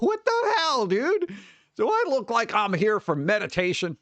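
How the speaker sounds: sudden high-pitched incredulous outburst; exasperated retort. Retold or imitated. Retelling a retort to the doctor about meditation, high incredulous squeak. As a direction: squeak it out in mock disbelief at the doctor.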